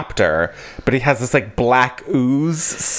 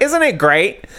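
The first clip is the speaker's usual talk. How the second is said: pushy recruiter; 13 semitones higher than usual. Retold or imitated. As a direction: sell it hard like a cult recruiter. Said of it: "And he's like".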